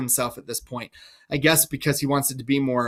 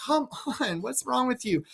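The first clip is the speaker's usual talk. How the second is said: higher, softer, coaxing gentleman; exasperated gentleman; 8 semitones higher, 7 dB quieter than usual. Acted. Gentleman to the raving Lear, softer and coaxing. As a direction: coax the old man gently. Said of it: "The gentleman's like".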